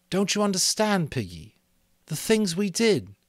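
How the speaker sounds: put-on high, loud, pained boy; anguished Ralph. Ralph pleading with Piggy over what they did. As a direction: plead to be understood.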